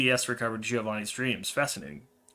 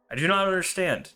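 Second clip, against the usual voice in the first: high, raised plea; distressed confusion; 9 semitones higher, 6 dB louder than usual. Acted. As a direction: protest loudly in bewilderment. Dreamer protests to the strange voice.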